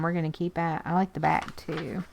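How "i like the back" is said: normal talking voice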